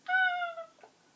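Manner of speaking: short high playful shriek of pretend fright; mock scream. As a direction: squeal as if the monster scared you. Mock fright at scary face.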